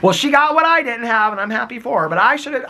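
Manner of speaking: a son doing his mother's voice; imitating mom. Son voices his mom being gracious about the stepmom. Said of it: "This is how my mom would talk".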